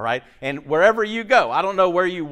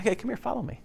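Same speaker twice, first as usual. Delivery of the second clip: casual, soft, higher-pitched invitation; not intimidating; 6 semitones higher, 9 dB quieter, faster than usual. Acted. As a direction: toss it off softly to one person. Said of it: "had to have been, like, that intimidating".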